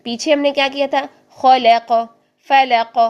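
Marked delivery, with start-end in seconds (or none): 1.3-3.0 خلق and فلق with the final ق bounced, louder; bounced qaf. Shown as a demonstration. Teacher reminding how قاف is shaken. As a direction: let the قاف echo. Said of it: "قاف کو ہلایا تھا".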